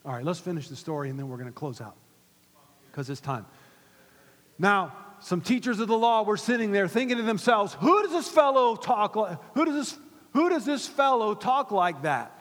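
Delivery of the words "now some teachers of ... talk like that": loud, high sermon reading; raised preacher's voice; forceful Bible storytelling. Real, not acted. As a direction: preach the story with indignant questions.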